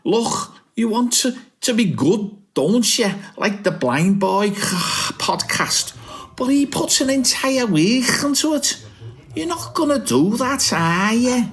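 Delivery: a lightly voiced high mate, pushy; high-pitched mate. Mate nagging Cal about his podcast, high put-on voice. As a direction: nag him in a high put-on voice.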